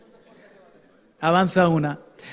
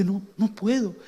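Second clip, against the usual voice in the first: tearful despair; 5 dB quieter than usual. Retold or imitated. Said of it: "Y se puso a llorar".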